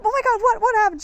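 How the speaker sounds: high and loud, eager disbelief; a friend dying to hear the news; excited friend. Retold or imitated. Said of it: "she was like".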